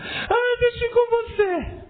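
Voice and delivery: put-on little kid begging dad to take him along, high and whiny; pleading child. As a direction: whine like a kid who wants to tag along.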